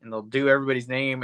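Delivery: normal talking voice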